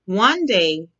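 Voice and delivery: ordinary delivery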